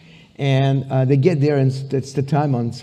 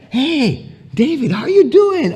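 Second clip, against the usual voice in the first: high-pitched, hammed-up delighted greeting; 11 semitones higher, slower than usual. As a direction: play a star thrilled to hear an old friend. Celebrity greets David on phone.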